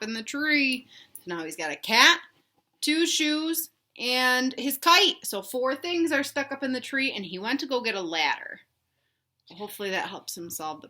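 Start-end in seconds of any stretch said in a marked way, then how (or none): none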